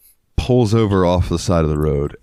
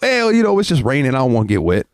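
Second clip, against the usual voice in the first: much higher, meek excuse; innocent drunk excuse; 6 semitones higher than usual. Retold or imitated. The drunk driver excusing himself from the rain, high meek voice. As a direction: play it innocent and dopey. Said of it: "and the guy goes".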